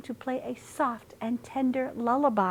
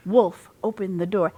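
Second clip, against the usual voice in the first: ordinary delivery, nothing marked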